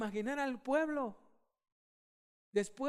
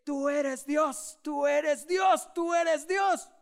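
slow, loud chant rising higher; loud shout; 5 semitones higher, 6 dB louder, much slower than usual. Pretend. We the crowd shout after the fire falls, loud. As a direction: proclaim it with full force. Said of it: "empezamos a decir fuertemente".

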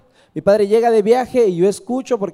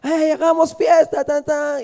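higher excited festive cheer; festive daughter; 7 semitones higher than usual. Acted. Daughter dancing out to meet the troops, excited. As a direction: celebrate with tambourines. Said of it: "salía alegre con panderos y danzas".

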